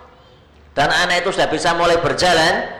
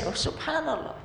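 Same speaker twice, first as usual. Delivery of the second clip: marveling sigh; 13 semitones higher, 11 dB quieter than usual. Acted.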